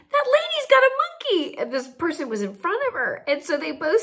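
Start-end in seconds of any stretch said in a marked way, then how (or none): 0.1-1.6 high, thrilled repeat of the news; excited repeat. Retold or imitated. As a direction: say it again, still amazed.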